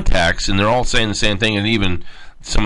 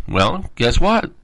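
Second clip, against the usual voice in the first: ordinary talk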